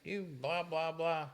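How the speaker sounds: hammed-up patient's rant in a high voice; over-the-top ranting. Someone in pain cursing at their partner, over the top. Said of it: "you can go over the top".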